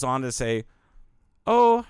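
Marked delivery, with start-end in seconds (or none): 1.5-1.8 very high, slow mock Mandarin accent; Tom Segura's Mandarin-accent bit. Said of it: "like, Mandarin accent".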